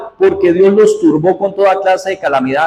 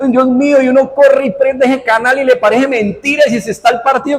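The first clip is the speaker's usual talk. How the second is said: normal talking voice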